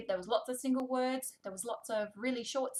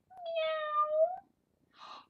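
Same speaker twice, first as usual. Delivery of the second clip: high, drawn-out kitty meow; cat meow; 4 dB louder than usual. Pretend. Voicing the book's cat for a toddler, high playful meow. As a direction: mew like a little cat.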